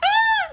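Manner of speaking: high, loud startled squeal; high puppet-frog squeak. Retold or imitated. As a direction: let out a squeaky creature yelp. Frog voice bursts out.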